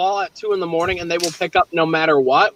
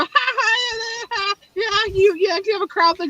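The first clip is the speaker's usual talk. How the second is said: high, giggly flirty dentist voice; mocking the dental assistant; 10 semitones higher, 3 dB quieter, slower than usual. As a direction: ham up a giggling, flirty woman.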